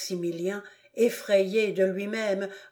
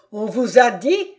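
angrier repeat; 3 semitones higher, 7 dB louder than usual. Acted. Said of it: "répéta le comte".

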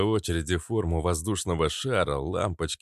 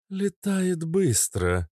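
female character voice; 11 semitones higher, much slower than usual. Acted. Kobra confirms.

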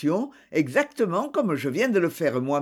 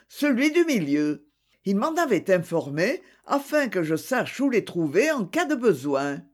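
ordinary delivery, nothing marked

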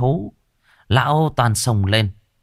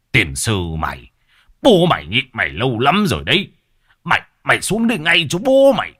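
angry scolding; 3 semitones higher than usual. A narrator's reading. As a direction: yell at the son up the tree. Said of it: "rồi chửi". (người bố quát con, giận dữ)

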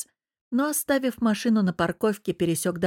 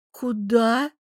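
higher, stunned single word; forced out, shaken; 4 semitones higher than usual. A narrator's reading. Anya learns they are leaving, strained dismay. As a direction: squeeze it out in dismay. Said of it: "выдавила, заметно побледнев".

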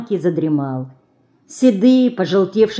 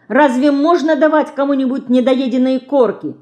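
put-on indignant girl, pitched higher; 6 semitones higher, 3 dB louder than usual. A narrator's reading. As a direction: protest it in high-voiced indignation. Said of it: "возмутилась Динка".

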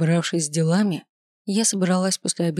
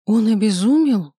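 a touch of shocked gasp; startled, a little louder; 3 semitones higher, 3 dB louder than usual. A narrator's reading. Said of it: "ахнула я".

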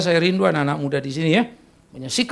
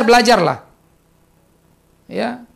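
ordinary delivery

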